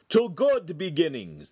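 bright toast with a big grin; beaming toast. A narrator's reading. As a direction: raise a glass, smiling broadly.

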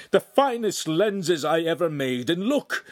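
high, loud indignation; enraged cry. A narrator's reading. Master shows apprentice the ruined lenses, enraged cry. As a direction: lament it loudly in anger. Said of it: "he cried, visibly shaking".

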